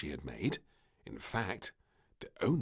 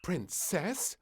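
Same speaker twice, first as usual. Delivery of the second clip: cross call; 11 semitones higher than usual. Acted. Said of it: "cried the maid crossly".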